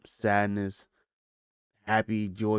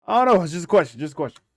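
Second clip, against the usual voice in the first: defensive outburst; 8 semitones higher, 9 dB louder, faster than usual. Real, not acted. Defends his question loudly.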